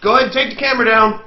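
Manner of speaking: calling out loud and high; retold call. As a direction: yell down to him from up top. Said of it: "he's like".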